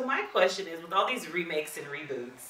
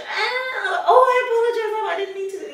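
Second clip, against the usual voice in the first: high crying wail imitating her toddler; crying toddler imitation; 8 dB louder than usual. Mom imitating Halo crying out an apology after a hard grab. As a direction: cry out the sorry like a hurt little girl. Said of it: "And she's".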